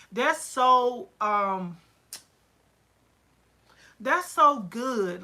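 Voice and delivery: slow, drawn-out so good; slow delighted emphasis on so; savoring it. Real, not acted. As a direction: stretch out so, savoring it. Savoring a customer's joy.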